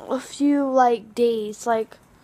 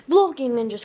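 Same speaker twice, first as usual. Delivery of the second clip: ordinary talk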